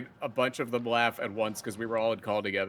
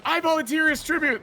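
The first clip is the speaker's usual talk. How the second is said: eager volunteer voice; 3 dB louder than usual. Retold or imitated. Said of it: "their spouse stands up and goes".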